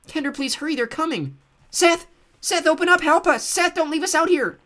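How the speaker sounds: put-on high child voices begging loudly; pleading children. A narrator's reading. Fake children outside begging Seth, high pleading cries. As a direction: beg to be let in like small frightened kids. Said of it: "voices of young children pleaded".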